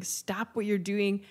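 ordinary delivery

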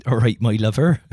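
mock West Country greeting to a co-host; Bristol accent. Mocking a co-host with a Bristol accent. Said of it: "I don't think you're from Bristol".

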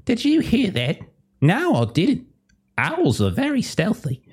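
high-pitched mock bystander voices; voiced onlookers. Game master voices someone looking around for an owl, high-pitched role play. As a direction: put on high little voices for a quick exchange. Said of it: "someone looks around like".

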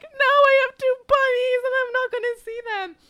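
half-acted tearful wail; mock crying. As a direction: wail it out as if crying over the bunnies. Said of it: "And, like, I'm crying".

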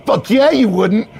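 high, emphatic bro bonding; hyped-up bro, chummy. Acted. Manager adopts new hire as his boy, chummy bro. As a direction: declare friendship like a pep rally.

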